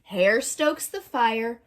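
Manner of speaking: usual speaking voice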